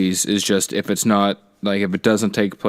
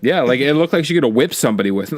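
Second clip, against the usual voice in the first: ordinary talk, nothing marked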